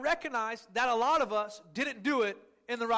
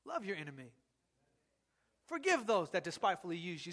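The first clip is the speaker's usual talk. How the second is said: much lower, much softer, calm; gentle scripture; 6 semitones lower, 6 dB quieter than usual. Retold or imitated. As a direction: lay the verse down low and soft. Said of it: "a biblical perspective is".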